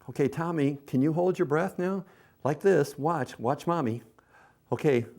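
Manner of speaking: put-on high, bright-eyed encouragement to a toddler; coaxing mom voice. Shown as a demonstration. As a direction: coax a little one to hold his breath. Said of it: "here's what you do".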